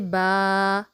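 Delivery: "बा" with the आ held long and slow; lengthened letter. Shown as a demonstration. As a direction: stretch the letter name out. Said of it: "बा को हम लंबा करके पढ़ेंगे". ("बा" को लंबा खींचकर पढ़ना)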